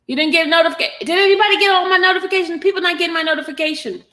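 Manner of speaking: high, fast exclaiming to the chat; excited calling out. Real, not acted. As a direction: call out to viewers, fast and high. Host calling out to viewers.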